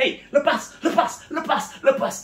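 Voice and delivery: high, sharp mock shout to let go; imitated angry pastor. Pastor trying to free his legs from the woman, high imitated angry shout. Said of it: "Pak Pendeta sampai marah".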